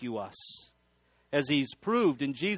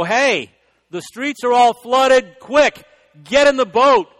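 higher and louder, calling out across floodwater; urgent call; 7 semitones higher, 10 dB louder, slower than usual. Retold or imitated. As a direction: shout to the man on the house to get in. Sheriff's boat calls flood victim.

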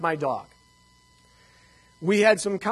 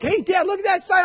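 put-on much higher, louder child voice; youngest son; 13 semitones higher, 6 dB louder than usual. William calls to his dad about the sign, high child voice. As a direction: play the eager little boy. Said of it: "William's like".

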